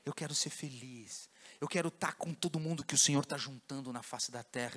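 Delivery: low, soft pleading prayer; humble prayer. Acted. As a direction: beg quietly and humbly.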